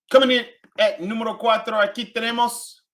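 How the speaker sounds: high, loud host voice; loud game-show countdown; countdown announcer. Acted.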